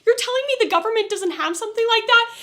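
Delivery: exasperated outburst at the plot hole; annoyed rant. Real, not acted. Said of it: "that pissed me off".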